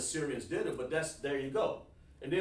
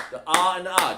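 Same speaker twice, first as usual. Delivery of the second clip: 1 loud emphatic repeat; 3 semitones higher, 11 dB louder, faster than usual. Real, not acted.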